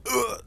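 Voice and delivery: high mock grunt of pain, like about to throw up; a winded kid's ugh, lightly imitated; mock retching groan. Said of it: "made a little sound".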